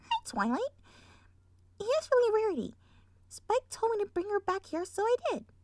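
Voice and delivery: high, sweet little filly. Acted. Sweetie Belle handing over Rarity, high sweet voice. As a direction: say it sweetly, like a child. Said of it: "in her sweet voice".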